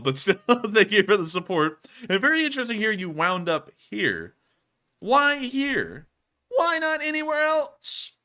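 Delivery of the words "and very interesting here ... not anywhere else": mock disbelief. Mock-incredulous reaction.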